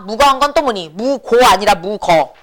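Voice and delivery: normal talking voice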